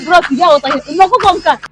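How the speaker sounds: normal talking voice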